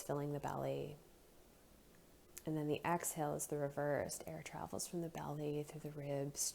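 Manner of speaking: soft, unhurried meditation voice with long pauses; guided breathing meditation. Real, not acted. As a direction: gently guide them into noticing the breath.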